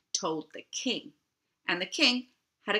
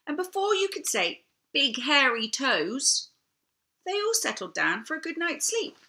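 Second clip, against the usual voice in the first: playful; 7 semitones higher than usual. A narrator's reading. Playful quick aside.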